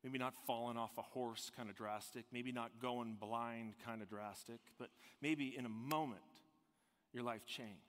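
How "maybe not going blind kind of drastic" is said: ordinary talk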